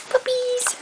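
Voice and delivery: high, cheerful shout; excited call. Real, not acted. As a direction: call the puppies happily. Calling the puppies.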